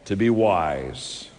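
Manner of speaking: slow, earnest prayer voice. Acted. Preacher leading the congregation in closing prayer, slow. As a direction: pray over them slowly.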